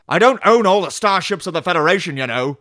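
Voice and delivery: loud, defensive retort; hammed-up protest in a quarrel; defensive captain. Acted Picard and Raffi quarrel.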